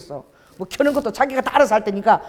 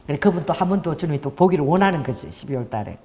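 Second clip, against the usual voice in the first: ordinary delivery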